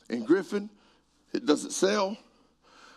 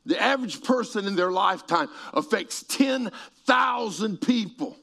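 usual speaking voice

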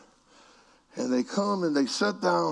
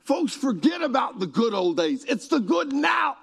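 high, emphatic exhortation; full-force preaching; 11 semitones higher, 5 dB louder than usual. Real, not acted. Preacher urging folks off the good old days, shouted preaching. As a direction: shout it to the congregation, rising to a peak.

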